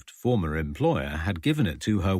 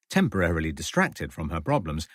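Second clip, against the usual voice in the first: usual speaking voice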